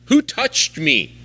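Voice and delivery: Jesus asks the crowd slowly, who touched me; searching question. Retold or imitated. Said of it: "Jesus asked".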